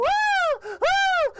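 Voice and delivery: piercing, very high loud shriek; cartoon scream. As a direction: scream at full pitch like a terrified cartoon. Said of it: "the screaming is still going on".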